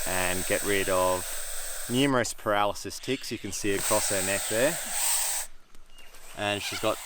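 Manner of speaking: hushed nature presenter; excited wildlife host whisper. Real, not acted. Hushed presenter talk beside the lizard.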